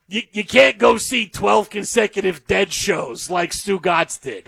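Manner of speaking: slow, drawn-out Stu Gatz voice; Stu Gatz impression. Co-host mocking Stu Gatz about [name] shows, slow drawl. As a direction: drag the words out like Stu Gatz. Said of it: "My Stu Gatz impression's pretty good".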